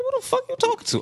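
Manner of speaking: high, startled disbelief; shocked. Retold or imitated. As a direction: caught off guard by a strange voice. Retold shock at friend's voice.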